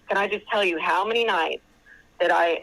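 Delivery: usual speaking voice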